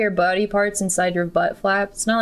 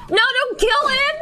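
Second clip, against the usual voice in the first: panicked shout; much slower than usual. Real, not acted. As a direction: yell at them to stop the killing, much higher. NPC about to be killed.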